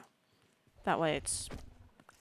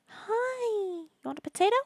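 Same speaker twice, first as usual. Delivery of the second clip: high pet voice; 13 semitones higher than usual. Real, not acted. Player greeting pet Rika, high pet voice. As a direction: sing out her name like greeting a puppy. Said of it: "With the stupid high voice".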